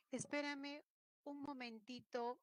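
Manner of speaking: ordinary delivery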